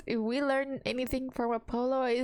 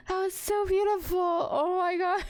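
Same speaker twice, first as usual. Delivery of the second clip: tearful, higher and choked; crying; 6 semitones higher, 4 dB louder, faster than usual. Real, not acted. Player moved to tears by the song, crying. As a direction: cry out the words. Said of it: "I'm, like, crying".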